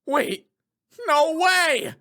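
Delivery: stunned realization. Acted. Lawyer realizing the shocking truth, building to a shout.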